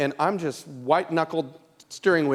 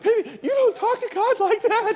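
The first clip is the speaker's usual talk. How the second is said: high, laughing mock warning; amused high-pitched chiding; laughing scold; 4 dB louder than usual. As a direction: giggle through a playful rebuke. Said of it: "I was just like".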